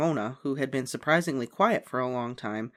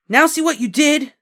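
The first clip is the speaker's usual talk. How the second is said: blaming; 13 semitones higher, 11 dB louder than usual. A narrator's reading. Boy blames girl.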